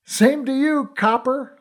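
high, bantering shout back; shouted reply. A narrator's reading. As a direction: call back over the noise, teasing. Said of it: "I shouted".

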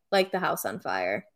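low, level deadpan impression; monotone. Imitating Brooks answering an early-morning phone call, monotone. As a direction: drop the pitch and keep it toneless. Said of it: "in his very monotone voice".

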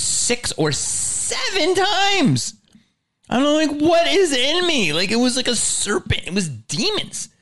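high, incredulous storytelling outburst; hammed-up disbelief. Storyteller reliving what came out of him, high mock-horrified exclaiming. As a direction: exclaim in mock horror, pitch way up. Exclaims over violent vomiting.

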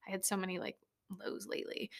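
breathy whisper of a sad admission; whispering lows lately; whispered confession. Real, not acted. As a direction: let it drop to a whisper. Confessing low mood.

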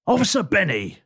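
clipped official introduction; officer voice. Acted. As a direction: announce your rank stiffly.

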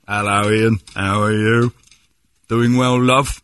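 teasing put-on English accent; playful English impression, lifting toward the end; mock British accent. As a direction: greet him in a fake British accent. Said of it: "You're doing the accent again".

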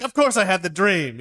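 high, loud mock-indignant insistence; fighting retort. Friend answers the doubting couple in a fight, indignant. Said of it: "There's a big fight".

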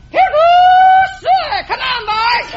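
high, loud hollering hog call. Pretend. Farm woman calling her hogs, loud high holler. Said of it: "let me hear you call a hog".

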